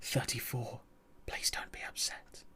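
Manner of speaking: breathy whisper in the ear. Pretend. Reading a creepy roleplay message, whispered. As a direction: whisper it close, soft and secret. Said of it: "whispering in your ear".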